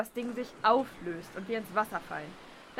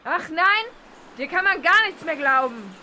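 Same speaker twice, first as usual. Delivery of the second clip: loud, higher sarcastic yell; scornful shout; 5 semitones higher, 10 dB louder than usual. Acted. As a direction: spit the distrust at her. Furious scorn at the mole.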